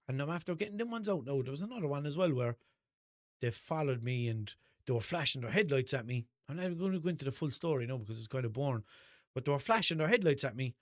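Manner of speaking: normal talking voice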